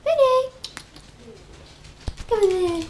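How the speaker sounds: loud high call across the room; calling the pet. Real, not acted. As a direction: shout his name up high to fetch him.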